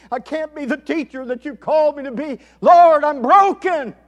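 imitated desperate prayer, climbing to a loud, high cry; crying out in desperation. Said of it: "He's crying out in desperation".

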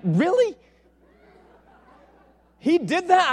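high, loud amazed disbelief; can't believe it happened; stunned disbelief. Retold or imitated. Preacher amazed at God's answer, high loud disbelief. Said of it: "I was like".

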